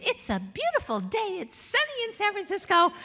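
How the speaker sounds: high, bright-eyed singsong greeting to the day; chirpy morning voice. Retold or imitated. To herself on waking, a high sunny singsong. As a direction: trill it like a cartoon morning person.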